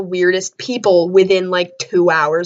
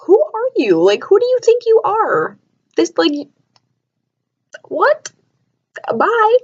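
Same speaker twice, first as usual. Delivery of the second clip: mock indignant; 11 semitones higher than usual. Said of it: "And I'm like".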